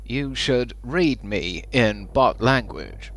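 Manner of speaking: slow, flat robot voice. Pretend. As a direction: speak like a slow machine. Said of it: "It does actually work in the robot voice".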